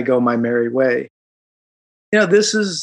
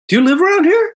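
voicing cop; 4 dB louder, faster than usual. Cop voiced, high far.

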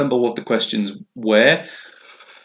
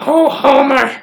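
Marge voice; 4 dB louder, much slower than usual. Acted. Marge scolding Homer.

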